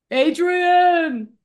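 mock bellow like a dazed boxer; Rocky's call. Co-host acting out the boxer calling to his wife, mock yell. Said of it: "the only thing he can say is".

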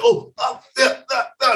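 imitated choking gurgle; mock choking gasps. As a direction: gasp like you're being choked.

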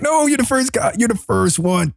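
high-pitched, giddy reassurance; hammed-up overjoyed insistence; gushing. Acts gushing reply to the passenger.

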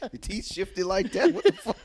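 high laughing squeal; real laughing outburst; laughing disbelief. Real, not acted. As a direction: crack up while asking. Laughing at friend's new accent.

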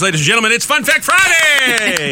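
high, slow game show announcer. Acted. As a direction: announce it big and drawn out. Announcing the segment.

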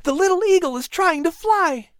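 put-on very high, thrilled boy's voice; excited exclamation. A narrator's reading. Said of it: "Patrick exclaimed".

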